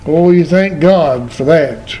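ordinary delivery

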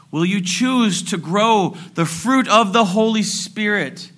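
high, emphatic pulpit challenge; preaching appeal. Acted. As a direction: press each choice on the congregation.

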